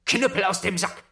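high, loud cry of the spell; triumphant shouted summons; shouted command. Acted. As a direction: yell it at the innkeeper. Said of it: "rief er".

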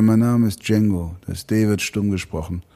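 low-pitched self-introduction in character; film gunslinger line. Acted. Voice actor quotes Django at the bar, low film voice. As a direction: state the name deep and cool. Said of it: "Und dann sagt er".

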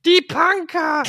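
high, panicked calling out; alarmed islander. Pretend. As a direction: shriek like a frightened local. Mock Sylt resident calling out.

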